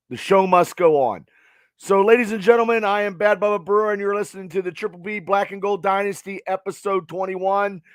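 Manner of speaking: show intro. Acted. Host launching the podcast intro.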